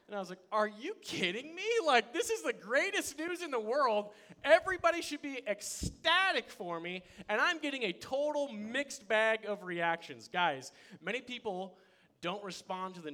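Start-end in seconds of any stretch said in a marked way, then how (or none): 0.5-10.3 much higher and louder, incredulous; can't believe they aren't thrilled; indignant excitement. Retold or imitated. Outraged at family's reaction.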